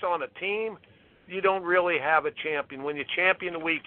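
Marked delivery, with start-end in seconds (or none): 2.8-3.8 fast, lower throwaway repeat; quick repeat. Real, not acted. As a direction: rush the line again under the breath. Slogan repeated quickly, quieter.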